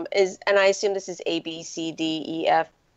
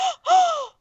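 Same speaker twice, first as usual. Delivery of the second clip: sharp delighted gasp; excited gasp; 4 dB louder than usual. Retold or imitated. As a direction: gasp in thrilled surprise. Excited gasp at rare deco.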